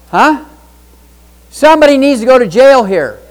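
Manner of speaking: loud, high, indignant; outraged demand. Real, not acted. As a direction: demand justice, loudly. Outraged call to audience.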